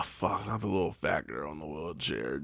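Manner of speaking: low, soft dismayed curse; quiet heartbroken curse; muttered despair. Retold or imitated. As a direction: groan it under your breath. Heart breaking at sight.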